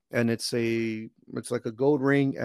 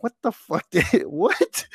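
high-pitched, baffled reaction; retold disbelief; 7 semitones higher, much slower than usual. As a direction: act out stunned confusion, voice jumping up. Retold shock at racist remark.

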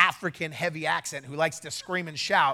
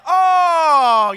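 loud, high drawn-out "oh"; exaggerated dawning understanding; mock realisation; 10 semitones higher, 16 dB louder than usual. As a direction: belt out a long, high oh. Acts out their belated realisation.

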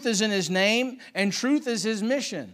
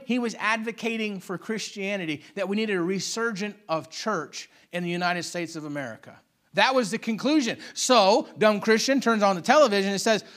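ordinary talk

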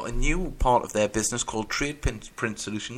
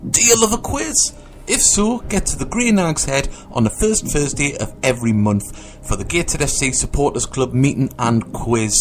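loud, punchy radio advert read; upbeat ad read; 9 dB louder than usual. Acted. Host reading the supporters club quiz advert, loud and punchy. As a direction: sell the quiz night to the fans.

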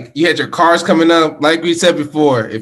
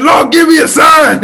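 a high, shouted rapper plea; 12 semitones higher, 6 dB louder than usual. Retold or imitated. Host quoting [name]'s prayer, shouted. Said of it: "the D M X voice".

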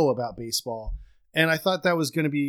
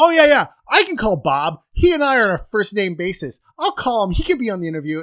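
louder, higher show-off boast; cocky bragging; 6 semitones higher, 7 dB louder than usual. Retold or imitated. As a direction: brag like you're old friends with him. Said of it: "Why am I talking like this?".

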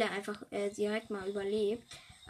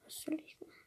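whisper; 5 semitones higher, 10 dB quieter, much faster than usual. Real, not acted. Whispered question.

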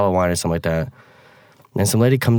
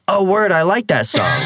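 put-on laughing kid reply; 9 semitones higher, slower than usual.

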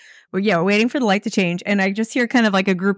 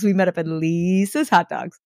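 slow mock delivery, long LEE in Lisa's; drawn-out catchphrase. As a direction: imitate the guys, stretching Lisa's. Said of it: "they always, they go".

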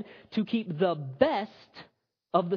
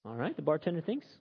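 ordinary delivery

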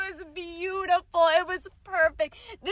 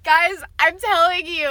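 ordinary delivery

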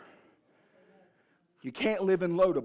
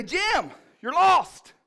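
slow, high, drawn-out mock scold; a wife calling out her husband; lightly voiced mom, drawn-out complaint; exasperated mom; 14 semitones higher, 12 dB louder, much slower than usual. Mom tells dad he's lost.

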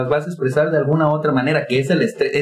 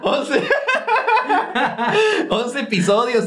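laughing through the words, pitched up; 9 semitones higher, slower than usual. Real, not acted. Host to cohost, cracking up about the episodes. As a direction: giggle out the count in disbelief.

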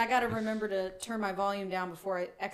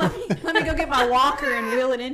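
laughing mock old man voice; 6 semitones higher, 7 dB louder than usual. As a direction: play a frail old-timer, half laughing. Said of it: "old as fuck".

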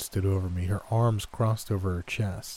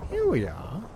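lilting granny sing-song; 4 semitones higher than usual. A narrator's reading. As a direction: sing it sweetly like a grandma. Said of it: "she said in a sing-song voice".

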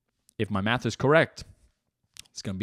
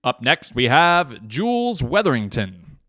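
loud, drawn-out announcer introduction; mock stadium announcer; event announcer; 6 dB louder, slower than usual. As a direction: announce the next athlete, stretching the name. Mock announcer introduces next athlete.

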